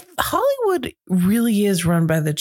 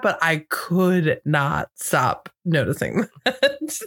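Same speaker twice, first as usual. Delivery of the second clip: normal talking voice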